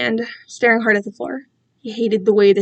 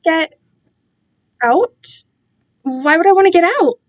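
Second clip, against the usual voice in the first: high, puzzled question; confused; 6 semitones higher, 3 dB louder than usual. Acted. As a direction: ask in honest confusion. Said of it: "genuinely confused".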